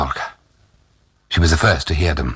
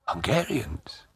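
much softer, higher question; hushed surprise; 4 semitones higher, 11 dB quieter than usual. A narrator's reading. Old Tag hears the soldiers are Hungarians, hushed surprised question. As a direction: echo it softly in disbelief. Taken aback by soldier's answer.

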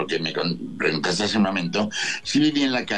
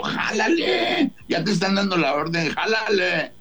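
slow high drawn-out taunt; taunting dare; 12 semitones higher than usual. Retold or imitated. Guest goading gunman, slow and high. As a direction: goad him, stretching Jálale. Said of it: "Y yo".

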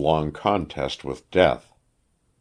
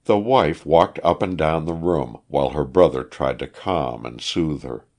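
plain reading voice

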